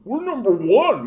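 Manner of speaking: high, slow whiny put-on voice; wobbly, dopey flag-waving boast, pitched high; mock American braggart. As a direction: caricature a dim, boastful patriot.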